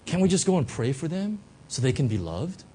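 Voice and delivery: ordinary delivery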